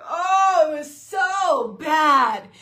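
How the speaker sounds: high, loud and very slow wail; dramatic groan of embarrassment; mock despair. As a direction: ham up the cringe of a bad post. Mock reaction to first reel.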